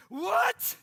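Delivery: loud, high shout of disbelief; sudden yelped challenge; incredulous Holy Spirit. Retold or imitated. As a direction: explode in shocked disbelief. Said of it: "And the Holy Spirit was like".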